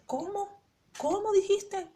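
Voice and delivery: the stunned mother asking her to repeat it; incredulous cry of a mother over the phone; shocked disbelief. Retold or imitated. Mother hears daughter quits church.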